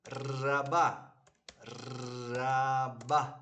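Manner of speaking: "raba" with a long trilled R at the start; Spanish rolled R. Shown as a demonstration. Teacher drills the word for learners, rolled R. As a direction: roll out the R of "raba", Spanish style. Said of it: "or like Spanish, arriba".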